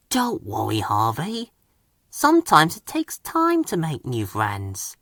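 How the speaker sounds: soft, gentle reassurance; comforting Thomas. A narrator's reading. Engine softly comforting sad Harvey, gentle. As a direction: quietly reassure a lonely friend. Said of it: "he said".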